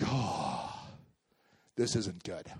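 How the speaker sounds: low, hushed uh-oh; quiet, sinking oh no; dawning dread. Retold or imitated. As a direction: realize you're in trouble. Car spun out, tires popped.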